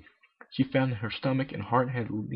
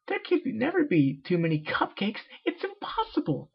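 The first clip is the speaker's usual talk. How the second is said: high, rising to a squeak; shocked Pinkie; 11 semitones higher than usual. Acted. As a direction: gasp it out in disbelief. Pinkie aghast at the idea.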